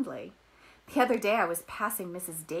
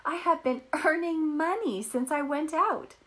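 much higher and louder, bursting with news; excited cry; 9 semitones higher, 6 dB louder than usual. A narrator's reading. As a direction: call out to the parents in delight, high and loud. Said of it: "she cried".

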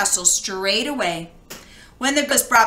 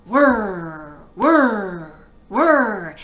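whirring wheel; 2 semitones lower, 3 dB louder, much slower than usual. A narrator's reading. Spinning wheel sound.

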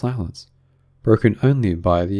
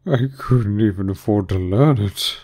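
slow, sighing regret. A narrator's reading. Mock Turtle admitting he missed washing, with a sigh. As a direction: let the line sag slowly. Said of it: "said the Mock Turtle with a sigh".

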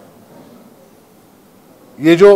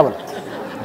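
ordinary delivery

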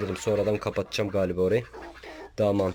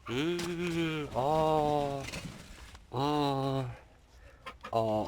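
ordinary talk, nothing marked

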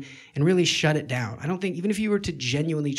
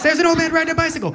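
fast, higher, louder blurt; 10 semitones higher, 7 dB louder, faster than usual. Acted. The distracted mind blurting out a random image. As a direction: rush it out as the thought hits.

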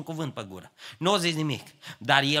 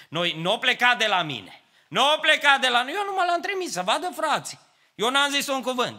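higher, louder mock-innocent whine; whiny excuse; 10 semitones higher, 3 dB louder than usual. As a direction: play the forwarder who claims innocence. Aron voiced denying blame. (vaitat ironic, mai sus, al celui care se scuză)

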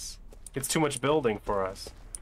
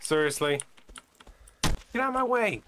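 scared horror game streamer; 4 semitones higher than usual. Real, not acted.

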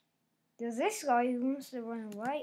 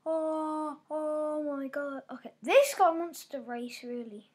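ordinary delivery

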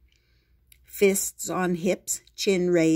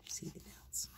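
breathy secret whisper; 21 dB quieter than usual. Acted. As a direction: whisper it to yourself. Unvoiced whisper aside.

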